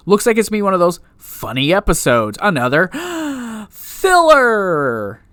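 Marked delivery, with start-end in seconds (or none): 2.9-3.6 mock gasp. Mock shock at more filler. 3.7-5.2 mock horror. Mock horror after gasp.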